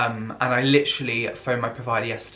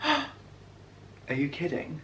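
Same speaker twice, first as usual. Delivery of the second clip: awed whisper; 3 semitones higher, 10 dB quieter, much slower than usual. Real, not acted. Awed by the box.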